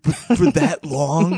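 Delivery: slow, drawn-out mock outrage; mocking the neighbor. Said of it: "He was like".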